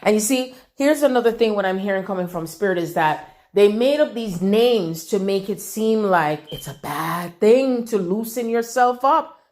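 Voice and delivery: usual speaking voice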